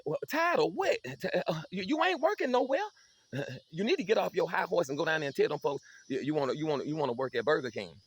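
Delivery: high, loud scornful scolding; a mother tearing into a lazy son; scolding mama. Retold or imitated. Mama scolds her idle son.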